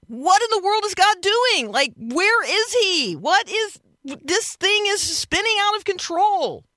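anguished, panicky question; despairing. Shown as a demonstration. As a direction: cry out as if everything is spinning out of control. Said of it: "depending on the inflection in your voice".

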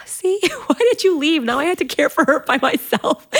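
high, loud complaint through a laugh; mock exasperation. Wife recalls blaming husband for leaving her, comically exasperated. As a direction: scold him in your head, half laughing. Retold annoyed thought at absent husband.

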